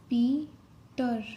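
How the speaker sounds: Peter drawn out very slowly, each sound clear; slow, careful teacher pronunciation of Peter; slow dictation. Shown as a demonstration. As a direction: stretch Peter out slowly so students can write it. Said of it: "Peter में टी आर की sound आ रही है".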